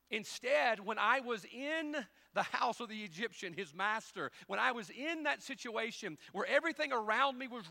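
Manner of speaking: loud, high preaching build; preaching at full force. Acted. Preacher rousing the church, loud and high. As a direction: press the point hard to the congregation.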